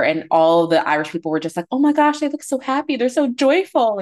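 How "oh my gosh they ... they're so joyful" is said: gushing. Retold or imitated. Quoting Irish commenters.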